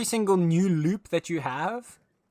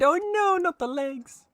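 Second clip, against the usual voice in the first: high squeak of mock dismay; mock horror; 4 dB louder than usual. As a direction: gasp it out in playful horror.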